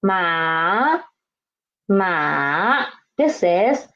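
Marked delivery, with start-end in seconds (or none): 0.0-2.9 ma dipping low then rising; third tone ma. Shown as a demonstration. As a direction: dip ma low, then lift. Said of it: "This one can be a little bit tricky".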